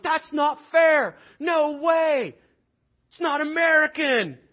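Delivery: high, full-volume shout of outrage; slow, hollered mock protest; outraged protest. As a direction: yell it like a brawl breaking out. Acted workplace brawl over pay.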